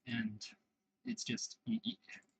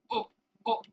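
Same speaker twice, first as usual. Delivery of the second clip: a sudden sharp yelp, pitched way up; startled yelp; 6 dB louder than usual. Real, not acted. Gamer spotting danger on screen, high startled yelp. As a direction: jolt out a high startled cry.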